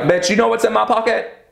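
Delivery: high mock-diva comeback; sassy retort. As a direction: snap back with sass. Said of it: "And I'm like".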